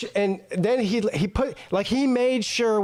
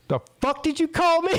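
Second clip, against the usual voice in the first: high, amused mock outrage; indignant comeback; 9 semitones higher than usual. [name] firing back at the waitress, mock indignant. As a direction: retell an offended challenge, pitched way up.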